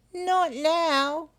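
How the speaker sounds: a knowing little echidna. Acted. Echidna answers.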